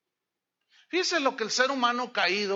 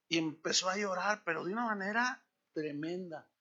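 usual speaking voice

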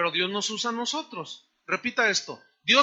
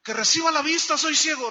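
high, loud, urgent plea; acted crying out; 6 semitones higher, 5 dB louder than usual. Blind man answers Jesus, acted crying out. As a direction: plead loudly as the blind man. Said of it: "grita un hombre que estaba ciego".

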